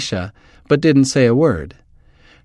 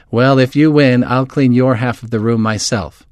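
plain reading voice

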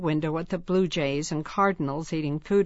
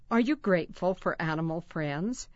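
ordinary delivery

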